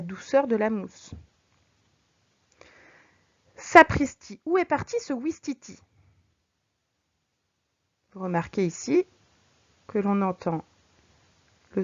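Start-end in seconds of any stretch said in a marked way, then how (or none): 3.6-5.8 sudden loud "Sapristi" then higher, startled question; mock surprise. Shown as a demonstration. Teacher acts out the surprise in the example. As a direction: sound amazed, the I sounds bright. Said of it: "Ça rappelle la surprise".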